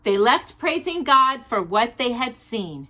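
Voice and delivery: gentle rhyming storybook read; reading to children. Acted. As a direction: read the picture book aloud to little listeners. Reads a Christmas storybook aloud.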